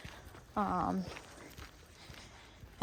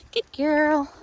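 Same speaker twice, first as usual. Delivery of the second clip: higher, louder dog praise; happy praise; 8 semitones higher, 7 dB louder than usual. Real, not acted. Trainer rewarding her dog's turn, high and loud.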